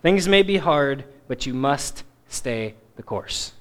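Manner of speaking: soft, slowing, tender exhortation; gently but firmly. Acted. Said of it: "it gently but firmly".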